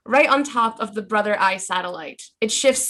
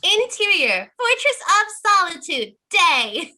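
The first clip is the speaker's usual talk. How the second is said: cute anime streamer; 9 semitones higher, 5 dB louder than usual. Acted. Reads stage direction in cute voice.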